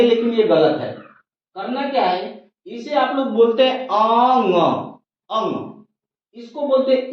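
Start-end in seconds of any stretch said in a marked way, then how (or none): 3.9-5.8 अंग said with a full vowel a at the start, as ang; the common wrong way: अंग with a clear leading a; wrong pronunciation. Shown as a demonstration. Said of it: "अशुद्ध उच्चारित करते हैं".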